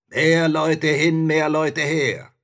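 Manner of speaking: slow, loud, high scoffing shout; spirit shouting. A narrator's reading. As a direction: brush it off in a drawn-out bellow. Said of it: "rief der Geist".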